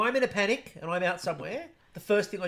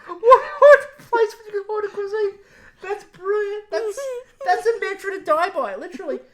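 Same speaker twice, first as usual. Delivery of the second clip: laughing delight; 13 semitones higher, 8 dB louder than usual. Real, not acted. Repeating joke in delight.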